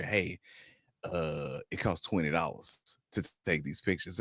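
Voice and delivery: hushed, confidential mock whisper; imitated whisper. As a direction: drop the volume as if slipping it into an ear. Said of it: "she gonna whisper in my ear".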